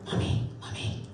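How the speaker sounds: hushed little voice calling mummy; child whisper. Retold or imitated. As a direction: whisper it like a kid at 4 am. Child waking mum at night.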